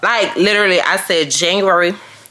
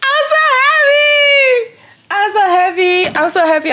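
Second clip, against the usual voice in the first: very high, stretched joyful shriek; ecstatic squeal; 4 dB louder than usual. Real, not acted. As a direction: squeal it, then gradually come down. Squealing with joy over subscribers.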